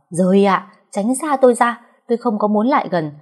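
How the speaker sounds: snapping irritably. Acted. Snapping at the pestering girl to get lost, angrily. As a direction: snap at her to go away. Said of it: "Mình gắt om sòm".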